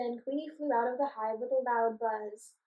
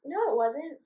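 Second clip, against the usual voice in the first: snapping; 3 semitones higher, 7 dB louder than usual. Acted. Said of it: "snapped the other one".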